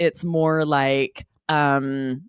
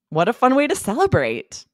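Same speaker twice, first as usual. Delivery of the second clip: higher, bright partygoer congratulating a friend; cheery party guest; 6 semitones higher than usual. Acted. A guest congratulating a writer at a party, pitched up and cheery. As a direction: sound delighted for her, pitched up. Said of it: "a role play".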